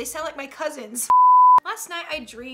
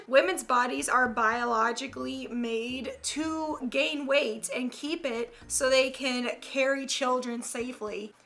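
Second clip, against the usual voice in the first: usual speaking voice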